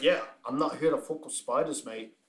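light imitation of an Australian accent; a casual hint of Australian, like quoting a mate. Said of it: "I just heard the accent".

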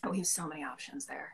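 unvoiced whisper. Real, not acted. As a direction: whisper it as an aside. Whispered aside.

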